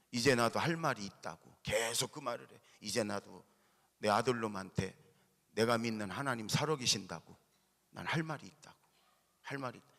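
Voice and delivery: ordinary delivery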